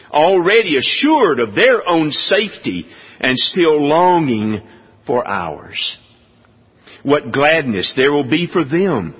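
stirring, emphatic reading of a sermon text; declaimed quotation, lifting on key words; oratorical reading. Acted. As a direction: read it out with rising fervour and measured pauses. Reads aloud a quoted passage.

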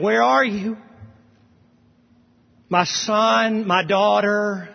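put-on high, loud tender call to a lost child; God calling out. God calling his son and daughter, loud tender call. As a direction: call out like a father searching.

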